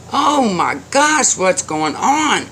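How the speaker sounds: loud, high, stretched-out exclamation; exasperated outburst. A narrator's reading. Old man hears another knock, exasperated. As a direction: blow up at one interruption too many.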